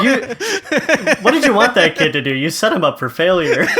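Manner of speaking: exasperated disbelief, half laughing; retold exasperation. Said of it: "And I'm like".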